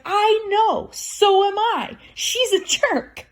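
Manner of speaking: high, slow put-on voice; mock indignant agreement. Agreeing with her aunt, high and slow in mock indignation. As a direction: drag it out in a high mocking voice. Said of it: "I'm like".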